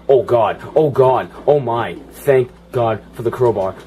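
loud, breathless stream of relief; panicked relief. Real, not acted. Player thanking God for the crowbar mid-fight, loud panicked relief. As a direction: blurt it loudly between gasps.

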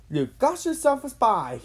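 high, loud, eager-to-be-heard call; shouting. A narrator's reading. Jimmy accepting the commander's mission, shouting. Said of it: "Jimmy shouted".

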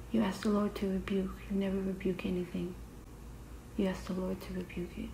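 soft prayerful whisper. Real, not acted. Whispered self-encouragement, faith.

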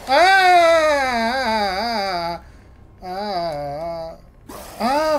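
loud high screams of panic; terrified screams. Real, not acted. Gamer chased by a monster, loud terrified screaming. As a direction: scream your lungs out while fleeing.

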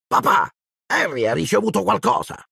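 put-on high, whiny boy telling on his cousin; spoiled boy tattling; tattling child. A narrator's reading. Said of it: "disse Dudley d'un tratto".

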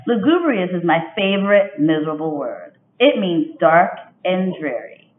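expressive picture-book read-aloud; reading to children. A narrator's reading. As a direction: read it like storytime for young kids. Teacher reads picture book aloud.

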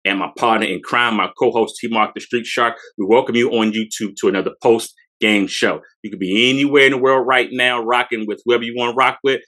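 ordinary talk

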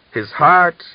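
loud and high, a furious cry; hissed. A narrator's reading. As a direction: spit the word heart out, loud and high. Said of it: "Miran hissed".

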